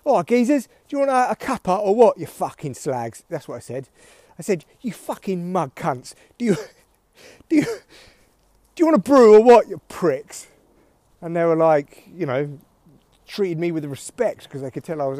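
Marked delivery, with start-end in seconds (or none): 0.0-3.2 loud, raised Cockney geezer; hammed-up Cockney banter; Cockney accent. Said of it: "doing my best Cockney accent". 4.8-10.4 mock-abusive Cockney, bursting louder; hammed-up Cockney tea offer; Cockney accent. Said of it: "doing my best Cockney accent".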